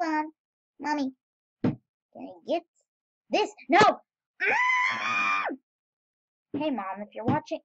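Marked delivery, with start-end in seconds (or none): 4.4-5.4 a very high, loud yelp of dismay; startled scream. Real, not acted. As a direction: cry out as the thing slips away. Losing the item he wanted.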